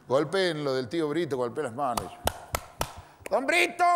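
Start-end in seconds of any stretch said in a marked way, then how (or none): 3.3-3.8 high-pitched holler across a distance; yelled call. Acted. Visitor calling the old man from the gate, shouting. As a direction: call out as if he were in another village. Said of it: "gritó como si el viejo estuviera en otro pueblo".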